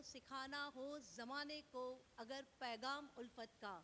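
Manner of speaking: measured, declamatory mushaira delivery; Urdu nazm recital. Acted. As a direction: recite each poetic line to an audience, letting the phrases ring. Poet recites her nazm.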